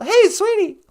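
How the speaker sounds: put-on high, loud old lady voice; grandma character voice. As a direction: chirp it like a sweet old granny. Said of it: "Nana Bonjour".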